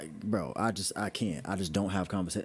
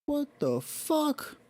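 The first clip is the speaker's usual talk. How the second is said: shocked; much slower than usual. Real, not acted. Shocked reaction.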